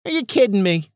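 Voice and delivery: exasperated disbelief. Retold or imitated. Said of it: "I'm sitting there going".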